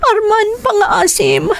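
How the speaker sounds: high, breaking voice close to sobbing; tearful pleading. Acted. Wife begging her husband Arman for mercy, crying. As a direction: beg him through tears.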